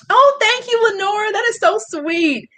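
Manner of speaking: high, bright gush of thanks; delighted thanks. Real, not acted. Streamer thanking a viewer for a kind comment, delighted. Touched by compliment.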